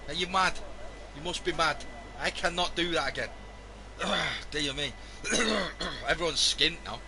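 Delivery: ordinary delivery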